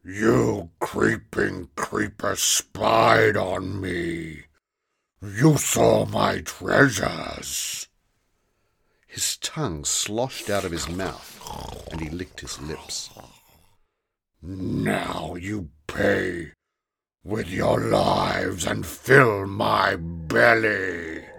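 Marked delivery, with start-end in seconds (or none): 0.0-7.9 slow, loud, dragged-out monster voice; a small green monster spelling out the accusation slowly; menacing monster. Acted. Grobble accuses the children. 14.4-21.2 slow, loud, dragged-out monster voice; a small green monster spelling out the accusation slowly; menacing monster. Acted. Grobble threatens to eat them.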